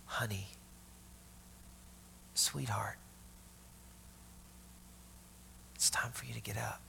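soft, unvoiced whisper of a loving parent; hushed, tender wake-up whisper. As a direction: whisper it like waking a child gently. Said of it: "what she would have heard was".

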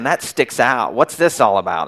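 usual speaking voice

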